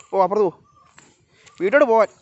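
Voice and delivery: loud, high go-away command; high, loud shooing; shooing an animal home. Real, not acted.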